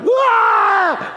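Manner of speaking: loud, high mock scream of alarm; startled scream. Speaker imitating startled audience members, loud high scream. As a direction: yelp as if jumping out of your seat. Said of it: "They went".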